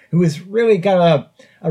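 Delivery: ordinary talk, nothing marked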